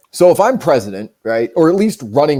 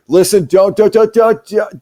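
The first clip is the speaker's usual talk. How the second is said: mock panicked interrupting; 5 semitones higher than usual. Acts campaign manager cutting him off.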